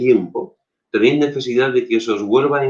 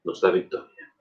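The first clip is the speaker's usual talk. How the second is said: soft echo; 4 semitones lower, 8 dB quieter than usual. Shown as a demonstration. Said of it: "repeated softly".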